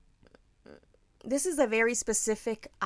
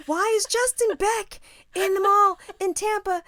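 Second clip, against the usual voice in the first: high, loud imitated disbelief; incredulous; 11 semitones higher, 8 dB louder than usual. Teller imitating the fan spotting Justin in the mall, incredulous. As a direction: voice a fan's amazement, pitched way up. Retold fan's amazed thought.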